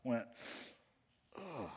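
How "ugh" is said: groggy groan. Acted. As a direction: groan like you've just been woken. Jesus woken from deep sleep.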